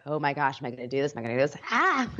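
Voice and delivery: low, panicky mutter ending in a yelp; mock anxiety. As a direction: fret then squeal. Acting out anxious person.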